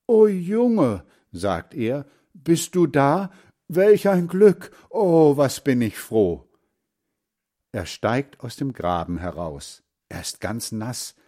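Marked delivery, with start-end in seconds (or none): 0.1-1.0 high, loud cry of relief; joyful outburst, voice leaping up; overjoyed relief. A narrator's reading. Grandfather overjoyed at finding boy. 2.3-6.5 high, loud, joyful exclaiming; elated outburst with raised pitch; overjoyed relief. A narrator's reading. Grandfather overjoyed at finding boy.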